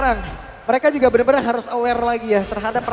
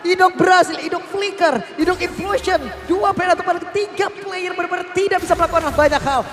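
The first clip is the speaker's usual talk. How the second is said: excited; 6 semitones higher, 5 dB louder than usual. Real, not acted. Co-caster recaps the teamfight.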